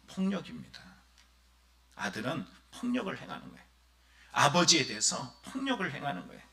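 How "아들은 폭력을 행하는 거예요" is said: ordinary talk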